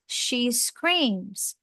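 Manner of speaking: normal talking voice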